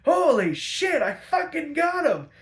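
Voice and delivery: high, loud triumphant yell; mock victory scream; hammed-up gloating shout; gleeful shout. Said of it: "he's like".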